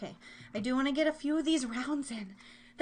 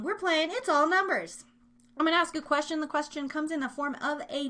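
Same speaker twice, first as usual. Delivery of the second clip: excited game announcement; 4 semitones higher, 5 dB louder than usual. Real, not acted. Re-announcing the game show round.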